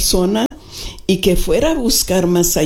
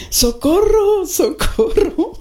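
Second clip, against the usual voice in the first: much higher pleading cry, the second one drawn out slow; calling for help; 12 semitones higher, slower than usual. Retold or imitated. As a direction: cry out for help from the flooded car. Woman calling out car window. (pidiendo auxilio, mucho más agudo)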